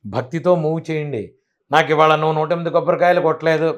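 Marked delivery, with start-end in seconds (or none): 1.7-3.8 mock complaining devotee.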